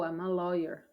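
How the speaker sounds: ordinary talk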